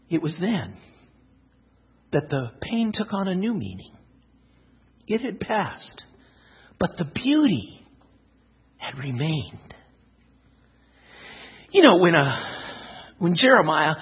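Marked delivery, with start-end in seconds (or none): 0.1-9.7 soft, reflective story ending; gentle, subdued closing narration; hushed story narration. Acted. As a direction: bring the tale to a quiet close, pausing between phrases.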